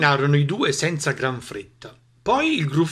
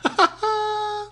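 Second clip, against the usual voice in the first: very high-pitched cackle; high mocking laugh; gloating giggle. Mouse laughs at the fooled snake, gleeful cackle.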